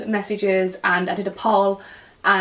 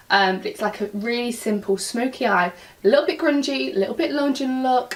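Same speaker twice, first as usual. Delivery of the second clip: usual speaking voice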